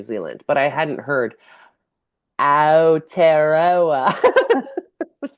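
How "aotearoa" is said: very slow, careful, louder Aotearoa; drawn-out attempt. Shown as a demonstration. Trying out a Māori word unheard before New Zealand, slow and exaggerated. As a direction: attempt Aotearoa syllable by syllable, laughing at the end. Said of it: "I don't know how to say".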